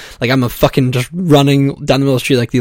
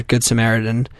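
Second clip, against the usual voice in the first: ordinary talk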